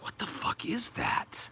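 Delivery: slow, hushed whisper; shocked whisper. Retold or imitated. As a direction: whisper it in disbelief. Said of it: "And I'm like".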